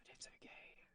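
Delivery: very soft, breath-only whisper; whispered reassurance. Pretend. Reassuring Nettie after news of arrest, whispered.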